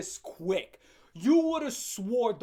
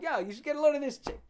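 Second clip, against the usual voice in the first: fast, higher bro voice to a pal; buddy chat; 6 semitones higher, faster than usual. Pretend. Talking to the dead bird like a buddy, fast. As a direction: gossip to the bird like a friend.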